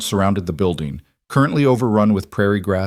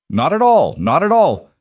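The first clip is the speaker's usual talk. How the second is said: loud, much higher jovial boom; the mayor booms his welcome to the teacher, loud and hearty; 10 semitones higher, 5 dB louder than usual. A narrator's reading. Big hearty boom. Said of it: "Mayor Donovan boomed".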